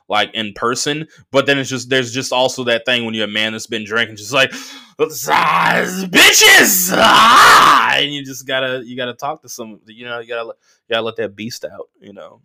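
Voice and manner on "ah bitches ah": put-on high, loud drunken holler; drunk man yelling. Guy who's been drinking, hollering loud, rowdy and high. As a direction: let the drunk beast out with a yell.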